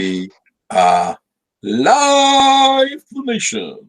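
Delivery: big drawn-out announcer call; hammed-up live-broadcast announcement. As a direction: boom it out like a show host. Announcing the stream opening.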